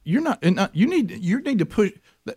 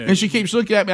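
usual speaking voice

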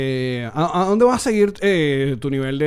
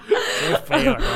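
laughing disgust; 12 semitones higher, much slower than usual. Real, not acted. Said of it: "laughing reaction".

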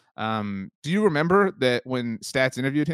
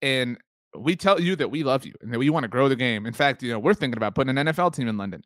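ordinary talk, nothing marked

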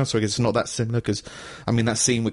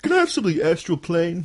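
mock customer order; 5 semitones higher than usual.

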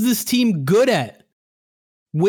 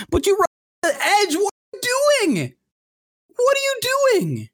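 rising to a high furious shout; exasperated yell at the play call; mad; 14 semitones higher than usual. Real, not acted. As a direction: blow up in disbelief at the coach. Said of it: "So it turns out I am mad".

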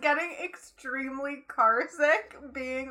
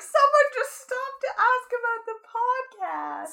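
excited; 10 semitones higher, slower than usual. Retold or imitated. Excited report to Logan.

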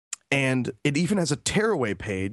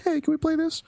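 imitating girlfriend. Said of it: "She'll be like".

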